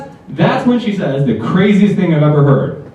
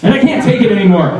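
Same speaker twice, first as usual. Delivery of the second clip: ordinary talk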